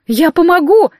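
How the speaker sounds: fervent assurance. A narrator's reading. Bearded captive promises to help, fervent assurance. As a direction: assure them eagerly. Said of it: "горячо заверил Нейт".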